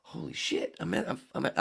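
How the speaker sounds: whispered high disbelief; hushed wow under the breath; awed whisper. Retold or imitated. Arriving at the Rainbow, whispering in awe. Awed inner thought at club.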